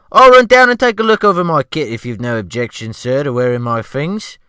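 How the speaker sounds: loud, high servile cook voice; ship's cook. Acted. The cook asking the rescued man, in a loud high fawning voice. Cook offers his own clothes.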